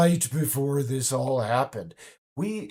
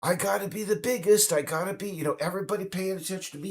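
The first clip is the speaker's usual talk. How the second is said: higher, boastful mock Trump voice, rushing at the end; mock Donald Trump; 7 semitones higher, faster than usual. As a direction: puff up like a braggart hungry for attention. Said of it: "he's like".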